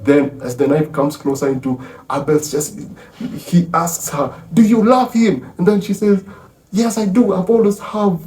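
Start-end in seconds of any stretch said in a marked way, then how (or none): 4.5-5.5 high, loud pressing question; urgent demand. Retold or imitated. Old man interrogates her about her love, high urgent demand. 6.7-8.2 impassioned confession. Retold or imitated. She finally confesses her love.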